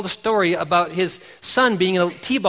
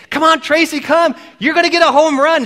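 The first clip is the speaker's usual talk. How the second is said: higher, louder, excited coaxing; coach urging; 8 semitones higher, 5 dB louder than usual. Retold or imitated. Coach at home base urging Tracy home, excited coaxing. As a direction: coax her home for the run. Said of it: "base saying".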